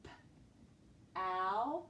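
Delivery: "P-ow" with a long gap after p; lone p, wait, then drawn-out ow in "P-ow"; sounding out. Shown as a demonstration. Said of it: "Go back to the beginning".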